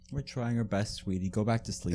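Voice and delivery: soft hushed calming tone; like a parent soothing a child. Retold or imitated.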